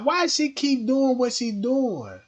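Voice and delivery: normal talking voice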